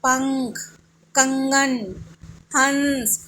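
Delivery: पंख, कंगन, हंस each drawn out with a held nasal; separated words, nasal sound lingered on; nasal anusvara. Shown as a demonstration. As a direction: hum the ं in पंख, कंगन, हंस through the nose. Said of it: "नाक से उच्चारण हो रहा है".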